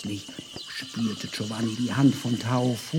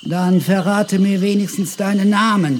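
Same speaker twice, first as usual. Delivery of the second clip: pushy pleading; 4 semitones higher, 6 dB louder than usual. Acted. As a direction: press her hard, then lay it on thick. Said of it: "drängelte Giovanni".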